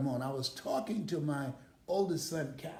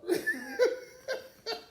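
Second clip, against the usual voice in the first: high laugh; 4 dB louder than usual. Real, not acted. Laughs at shared experience.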